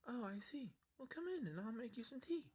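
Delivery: high, gentle pony voice; high and gentle, welcoming a guest in; shy pony voice. A narrator's reading. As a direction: offer tea like a timid pony. Fluttershy voiced by narrator.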